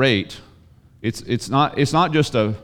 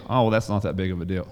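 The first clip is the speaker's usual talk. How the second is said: dismissive shrug; 5 semitones lower, 5 dB quieter, faster than usual. Retold or imitated. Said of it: "he's supposed to just sit back and say".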